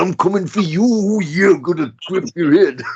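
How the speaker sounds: high, loud growling promo; wrestler promo voice. Retold or imitated. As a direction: shout it like a wrestler cutting a promo. Mock threatening wrestler promo.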